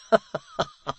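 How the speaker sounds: a sly amused chuckle; laugh. Acted. Secretary laughing off Hugh's fury, mocking laugh.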